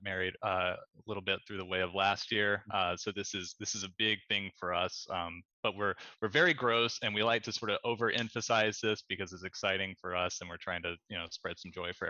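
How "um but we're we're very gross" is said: ordinary delivery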